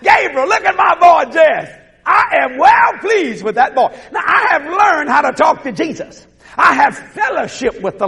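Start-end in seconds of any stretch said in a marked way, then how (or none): 0.0-3.9 higher, excited call to an angel; proud God boasting. Acted. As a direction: show off your boy, calling out. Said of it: "But God would say".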